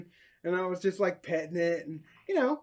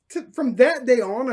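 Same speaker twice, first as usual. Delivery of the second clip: ordinary talk, nothing marked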